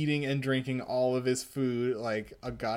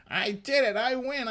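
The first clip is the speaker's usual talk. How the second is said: gloating; 10 semitones higher, 3 dB louder than usual. Acted. Kid gloats over winning.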